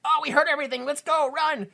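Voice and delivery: high, urgent squeaky panic; panicked mice. Retold or imitated. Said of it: "Ronnie and Top Connors are like".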